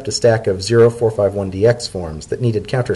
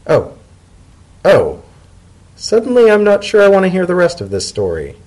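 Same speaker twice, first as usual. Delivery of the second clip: higher, uneasy Leafy voice; wary realisation; 10 semitones higher, 5 dB louder than usual. Acted. Leafy realises Dotted used the spy agencies, uneasy. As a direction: back away from knowing more.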